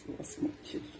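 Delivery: put-on whispered small small children. Aside to the crowd, whispered. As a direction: breathe it as an aside.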